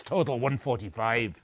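normal talking voice

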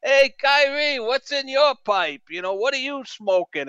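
high Boston heckler call; Boston fan accent. Retold or imitated. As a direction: mimic a New England fan taunting from the stands. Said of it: "that accent".

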